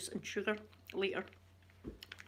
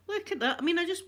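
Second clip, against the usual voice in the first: surprised; 9 semitones higher, 3 dB louder, faster than usual. Real, not acted. Shows messy hair.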